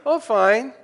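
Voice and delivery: high, loud fake "fine"; brushing it off. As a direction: cover it up with a breezy answer. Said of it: "somebody says".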